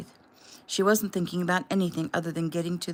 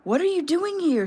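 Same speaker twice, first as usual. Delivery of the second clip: rising from alarm to much higher, louder near-hysteria; frantic questions climbing toward hysteria; edge of hysteria; 10 semitones higher, 5 dB louder than usual. A narrator's reading. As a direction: panic building with every question. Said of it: "her voice on the edge of hysteria".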